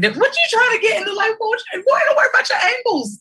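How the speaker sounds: very high playful scolding; aunt teasing her nephew on camera; teasing squeal. Real, not acted. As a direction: tease him in a high squeal. Mock scolding the boy.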